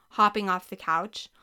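ordinary delivery, nothing marked

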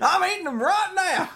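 high, loud laughing cowboy voice; a chuckling old-timer cowboy; old Western accent. Acted. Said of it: "makes you talk like an old Western dude".

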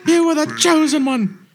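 high, pleading Obi-Wan line; dramatic movie quote. Retold or imitated. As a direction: quote it like the tragic scene. Quoting Obi-Wan to Anakin.

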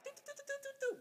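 high rapid "do-do-do" noise; brain short-circuiting. Retold or imitated. Woman imitating her brain during an argument, scrambling. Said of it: "my brain was like".

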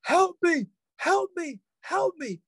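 high-pitched mock scream for help; high, held-back cry for help; imitated screaming. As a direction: imitate a panicked scream without full volume. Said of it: "He just started screaming".

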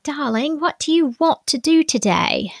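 high, put-on British accent. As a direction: play a fond British "darling". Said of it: "If you do it in a British accent".

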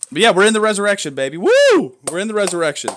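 high, loud, fast celebratory burst with a whoop; jubilant cheer. Real, not acted. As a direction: whoop it up like the party just started.

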